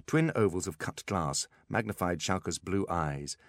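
plain reading voice

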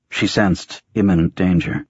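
plain reading voice

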